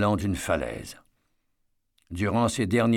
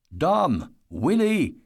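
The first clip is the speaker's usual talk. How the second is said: calling out; 7 semitones higher, much slower than usual. Acted. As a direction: shout across to get their attention. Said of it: "cria Eddie".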